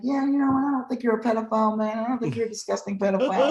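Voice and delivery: high-pitched fake admirer; mock-friendly prankster. Prankster trolling politicians at their rally, mock-friendly. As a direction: keep up the high, chummy imitation.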